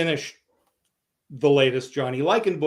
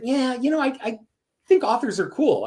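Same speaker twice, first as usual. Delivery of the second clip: put-on young self; 10 semitones higher than usual. Twelve-year-old self answers mayor.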